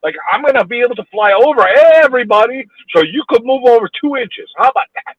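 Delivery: loud, high-pitched exasperation; angry rant. Real, not acted. Driver railing at a honker stuck behind him, angry rant. Driver ranting at honking motorist.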